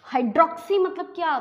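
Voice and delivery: ordinary talk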